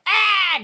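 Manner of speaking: loud, high imitated yelp; sudden cartoonish cry of pain; mock pain scream. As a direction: scream out as if hit, hammed up. Imitating Bert shot in leg.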